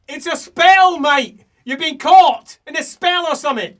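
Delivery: high, very loud character voice; loud in-character exclamation. Acted. Character explaining a spell to someone, loud and high. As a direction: call it out high and full-volume.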